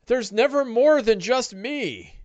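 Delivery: high, pleading oafish voice; frightened ogre. Acted. Wounded ogre insisting he is alone, high pleading voice. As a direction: protest pitifully in a high voice. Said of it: "oafish viciousness to fear".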